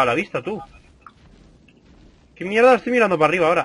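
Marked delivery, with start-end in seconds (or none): 2.4-3.6 high, loud startled swearing; startled cursing. Real, not acted. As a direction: blurt it out, thrown off. Camera stuck looking up.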